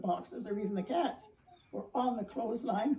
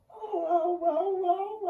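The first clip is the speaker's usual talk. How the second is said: high, loud wailing oh oh; exaggerated moan; 11 semitones higher, 7 dB louder, much slower than usual. A narrator's reading. Pine tree moaning at the dog, high and loud. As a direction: wail out in mock despair. Tree's mock exasperated moan.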